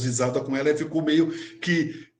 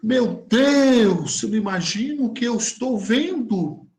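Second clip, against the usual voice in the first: drawn-out, high exclamation of disbelief; mock astonishment; 6 semitones higher, slower than usual. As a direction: imitate a woman amazed by a vision. Retelling the astonished medium's exclamations. (espanto teatral imitado)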